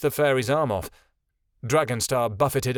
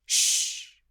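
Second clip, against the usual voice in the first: hush. A narrator's reading. Hushing a grieving friend.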